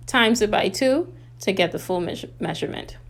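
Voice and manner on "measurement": normal talking voice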